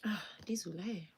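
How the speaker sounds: soft whispered apology. Real, not acted. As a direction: whisper it so nobody hears. Whispered aside.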